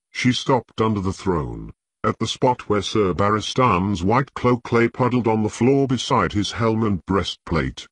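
usual speaking voice

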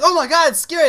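high, loud mock fright; mock scared. A kid seeing the Sandman, mock scared. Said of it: "you're gonna be like".

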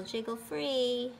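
ordinary delivery, nothing marked

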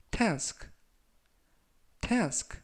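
task said slowly and clearly, falling; teacher reading task slowly for learners; slow model pronunciation. Shown as a demonstration. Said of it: "제가 천천히 세 번씩 읽어드리고요".

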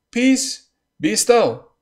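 loud, high command to the storm; Jesus rebuking the sea, commanding. Acted. Jesus commands the wind and sea, loud rebuke. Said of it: "rebuked the wind and said".

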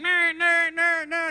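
hammed-up cries of no; mock terrified pleading; mock panic. Victim scene voiced.

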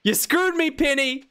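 high-pitched playful outrage; mock accusation. Streamer blaming Penny for the wasted chat, mock outraged. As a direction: wail the accusation in mock betrayal.

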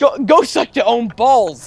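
high-pitched put-on Scottish accent. Player finishing the quoted insult for laughing friends, Scottish accent. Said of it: "In, like, a Scottish accent".

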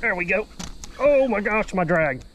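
ordinary delivery

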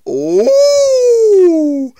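gasp, then a long high "ooh"; impressed, sliding ooh; high drawn-out ooh. Pretend. Reacting to raised hands.

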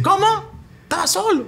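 high disbelieving commentator cry; excited catchphrase call. Retold or imitated. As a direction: shout your famous line at a missed goal. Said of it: "signature narration call".

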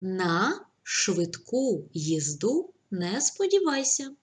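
slow, even dictation pace. Shown as a demonstration. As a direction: spell it out slowly for the class. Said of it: "dictating sentence".

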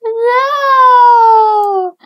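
long, high, loud cry of protest; anguished wail. Acted. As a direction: wail it out, stretching the vowel. Grounded daughter protests.

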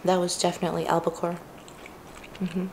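talking with food in mouth; chewing while talking. Real, not acted. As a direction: mouth partly full. Seen 1: Ate nigiri at -9; lips pursed, chewing through the words.

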